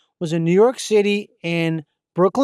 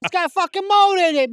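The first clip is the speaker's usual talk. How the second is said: high outraged repeat; 9 semitones higher than usual. Real, not acted. Repeats louder, higher to Mitch.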